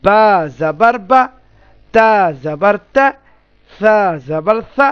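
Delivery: slow spelling chant "বা জাবার বা, তা জাবার তা, সা জাবার সা"; teacher slowly modelling "তা জাবার তা" with open a vowels; model pronunciation. Shown as a demonstration. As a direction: stretch the first letter in "বা জাবার বা", land on a clear open a. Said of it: "এইভাবে উচ্চারণ করবো".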